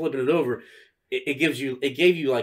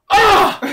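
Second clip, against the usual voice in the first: loud, high imitated squeal; sudden hammed-up kid scream; child's shriek; 13 dB louder than usual. Retold child's reaction to the reveal.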